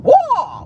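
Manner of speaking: high, loud karate battle cry; Bruce Lee kiai. Retold or imitated. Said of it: "That's that warning".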